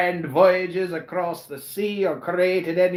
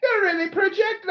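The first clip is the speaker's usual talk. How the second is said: high squeaky leprechaun voice, higher; 13 semitones higher than usual. Acted. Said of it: "He does sound like a leprechaun".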